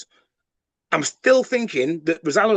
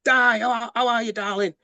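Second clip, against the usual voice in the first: retold gushing hello, pitched higher; mock affectionate greeting; 6 semitones higher than usual. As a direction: greet her like family, lightly imitated. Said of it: "he's kind of going".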